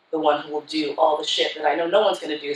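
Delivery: usual speaking voice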